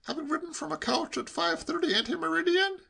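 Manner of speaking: high, mock-formal question; incredulous nephew. A narrator's reading. Nephew cross-examining uncle with pompous wording, incredulous. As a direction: query it loftily in a high voice.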